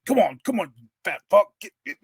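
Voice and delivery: imitating an impatient helper; dad straining to haul. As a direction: urge him along with effort. Imitating dad hauling him.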